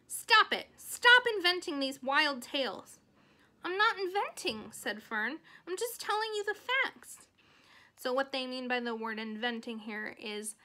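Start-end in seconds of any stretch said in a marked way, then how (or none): 0.0-3.0 loud, high-pitched scolding; angry order, much louder and higher; mother yelling. Acted. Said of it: "so her mom kinda yelled". 3.6-4.7 put-on high, loud girlish protest; indignant protest. Girl protests mother's scolding. 5.7-7.3 put-on high-pitched insistent little girl; indignant protest. Girl protests mother's scolding.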